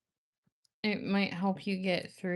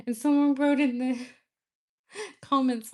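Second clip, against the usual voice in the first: high, loud, breaking into a laugh; 6 semitones higher, 8 dB louder than usual. Real, not acted. Telling viewers about a funny comment, laughing. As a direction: tell it through rising laughter.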